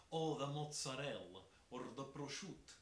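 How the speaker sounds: imitating New York Italian-Americans; New Jersey Italian accent. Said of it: "the way that Italians from here, New Jersey, New York".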